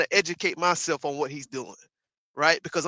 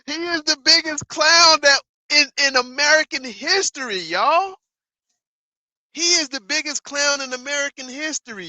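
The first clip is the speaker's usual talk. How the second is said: higher and louder all through; loud outburst; 8 semitones higher, 7 dB louder than usual. Real, not acted. Streamer shouting to chat that Trump is a clown, loud.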